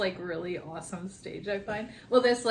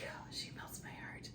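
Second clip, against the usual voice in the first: soft, whispery gush; melting tenderness; 16 dB quieter than usual. Retold or imitated. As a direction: breathe it out tenderly, nearly whispering. Mother voicing her tender thought.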